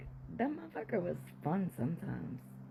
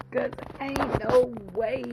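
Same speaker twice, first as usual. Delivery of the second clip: louder, higher, slow refusal; defiant shout; 5 semitones higher, 7 dB louder than usual. Real, not acted. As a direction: belt out the refusal. Defiant outburst.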